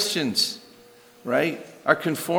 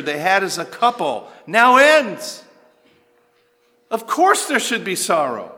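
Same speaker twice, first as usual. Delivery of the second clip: higher, driving sermon voice with heavy emphasis; impassioned preaching; 8 semitones higher, 3 dB louder than usual. Real, not acted. Preacher urging the congregation that of course they should grieve, impassioned. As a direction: preach it forcefully, punching the key words.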